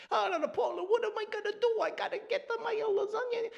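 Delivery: high, frantic Italian neighbor voice; Italian accent. Retold or imitated. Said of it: "the Italian thing".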